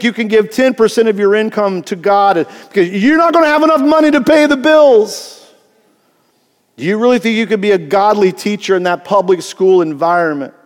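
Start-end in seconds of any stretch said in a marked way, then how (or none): 2.7-7.8 high scoffing taunt; mocking taunt. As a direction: sneer the doubt at them. Voicing the enemy's taunt.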